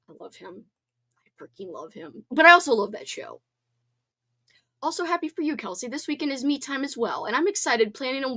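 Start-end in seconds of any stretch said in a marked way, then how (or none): 0.1-2.2 much softer, lower, dreamy confession; swooning hush. Real, not acted. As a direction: melt over a crush. Swooning over celebrity crush.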